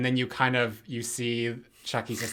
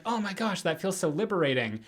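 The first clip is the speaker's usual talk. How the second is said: a hint of a relieved cartoon baby, pitched higher; lightly acted relief in a higher voice; Stewie Griffin; 7 semitones higher than usual. Baby confesses his real voice to a therapist, mildly relieved. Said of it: "his regular voice".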